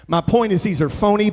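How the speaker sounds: normal talking voice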